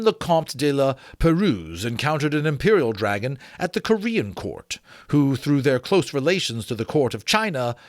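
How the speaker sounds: measured, formal read-aloud narration; story narration. Acted. Reading an old dragon-lore excerpt to listeners, measured narration. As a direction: read it like a learned old treatise.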